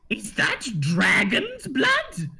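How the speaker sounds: slow, hushed awe, drawing out the words; awed gnome. Acted. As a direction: marvel at the dragon's blood. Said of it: "he leans forward and he says".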